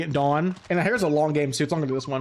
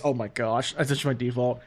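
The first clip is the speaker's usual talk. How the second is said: ordinary delivery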